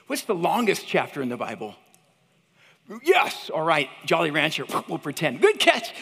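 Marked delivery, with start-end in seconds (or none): 3.0-3.5 high, loud cheer for a right answer; exclaimed quizmaster praise; excited approval. Real, not acted. 5.4-5.9 high, loud cheer; exclaimed, punchy congratulations; excited praise. Real, not acted. Praising audience member in quiz game.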